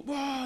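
mock crowd cheer. Imitating parade crowd cheering.

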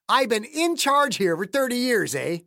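put-on affronted accented old-timer; offended old man. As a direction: throw the challenge out high and indignant. Voices offended caretaker Clayton.

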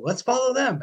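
acting out an eager urge to set off; eager rallying call. Voicing hunters setting off after their dogs, mock eager.

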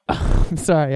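usual speaking voice

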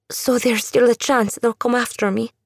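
put-on a frightened girl asking; fearful young woman. Andula voiced by narrator.

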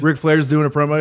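normal talking voice

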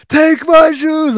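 very high, slow, squeaky imitation; mock surrender. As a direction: hand it over in a drawn-out falsetto. Mimics the man giving up shoes.

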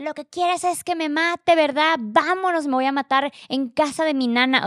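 high-pitched, drawn-out mock outburst; imitated yelling. He comes back downstairs yelling at his partner, imitated outburst. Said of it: "vuelve a gritar otra vez".

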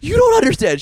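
high, loud mock panic; hammed-up frantic cry; freaking out. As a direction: imitate someone losing it, voice shooting up. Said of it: "She starts freaking out".

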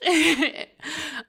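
high-pitched laugh. Real, not acted. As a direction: burst into a giggle. Laughs after her rant.

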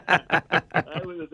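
real laugh breaking through the words, bursting loud; can't finish for laughing. Real, not acted. Cracking up at the story.

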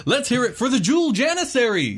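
high, loud goblin announcer; booming prize announcer. Acted. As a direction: hype up the crowd like a goblin MC. Announcer introduces the teams.

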